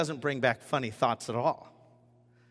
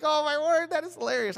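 amused; 12 semitones higher, faster than usual. Retold or imitated. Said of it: "I was like".

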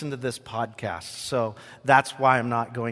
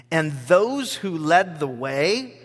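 ordinary talk

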